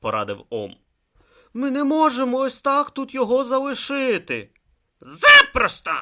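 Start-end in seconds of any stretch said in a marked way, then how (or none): 1.5-4.5 high, pleading objection; urgent protest. A narrator's reading. Brutha argues with Om. 5.0-5.9 loud, very high-pitched snap; scornful retort. A narrator's reading. Om retorts in the argument.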